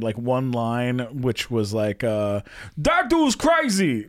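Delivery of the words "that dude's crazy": high, slow cartoon rabbit; drawn-out, high-pitched comic outburst. Shown as a demonstration. Actor doing the rabbit's one line, comic impression. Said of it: "a little like [name]".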